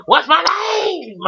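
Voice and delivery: high shrill cry of the taunt; mock villain screeching her own name; shrieked taunt. Imitating Uma's taunt, climax.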